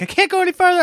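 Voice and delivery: very high-pitched mock child whine; whining kids. Kids telling dad they are finished, mock whining. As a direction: squeal it like a kid giving up. Retold exhausted kids complaining.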